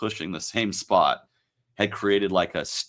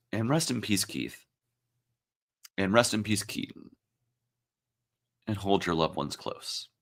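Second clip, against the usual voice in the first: choked up; 6 dB quieter than usual. Real, not acted. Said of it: "it is getting emotional here".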